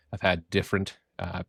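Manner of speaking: normal talking voice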